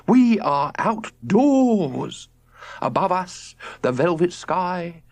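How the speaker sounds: rapturous nature lover. A narrator's reading. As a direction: rhapsodize about the night sky. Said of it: "his father talking like this".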